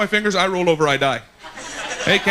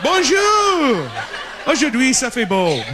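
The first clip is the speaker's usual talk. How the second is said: French weatherman; 6 semitones higher, slower than usual. Retold or imitated. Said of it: "They're like".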